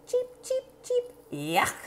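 high peeping then a soft yuck; a baby bird refusing worms; chick disgusted. Acted. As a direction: Carlos cheeping in disgust. Carlos as chick dislikes worms.

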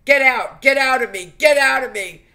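loud, high shouted command, retold; retold shouting. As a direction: yell it at the demon as you relive it. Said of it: "I actually was saying".

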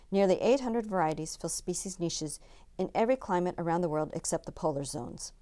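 usual speaking voice

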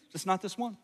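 ordinary talk, nothing marked

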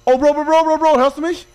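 urgent loud call to a teammate. Real, not acted. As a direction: call him loudly, urgently. Calling teammate in panic.

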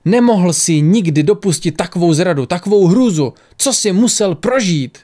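high-pitched, anguished outburst; crying out. A narrator's reading. Horrified woman asks what he had to suffer, crying out. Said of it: "křičela".

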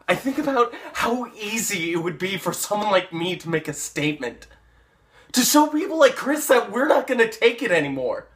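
loud, high, building to a shout; furious rant. Acted. Paul vowing to stand up to Chris, furious and shouting. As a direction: rant with rising fury. Acted, threatening violent revenge.

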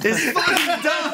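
high, slow words through laughter; laughing. Real, not acted. Admitting to friends the story sounds dumb, laughing. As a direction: laugh while protesting.

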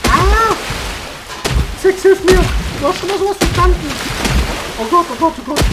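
being chased. Real, not acted.

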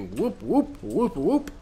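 rising whoop whoop siren call; police siren. Retold or imitated. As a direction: imitate a police car siren. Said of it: "That's my impression of a police car".

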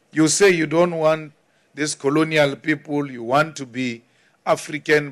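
ordinary talk

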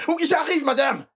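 high, loud reply; calling back. Acted. Servant shouts back to madam that he is coming, high and loud.

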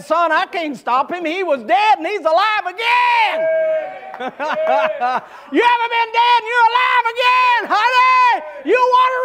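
loud, high shouted revival preaching; preaching at full force. Acted. Preacher acting out the father and sons, shouted full-force preaching. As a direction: drive the story home to the pews.

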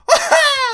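very high, loud cackle of panic; shrieking laugh. Real, not acted. Gamer laughing as enemies trap her, high shrieking laugh. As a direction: let out a squealing laugh. Trapped mid-fight.

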